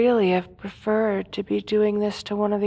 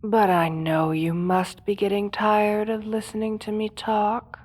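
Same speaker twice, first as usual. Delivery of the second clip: ordinary talk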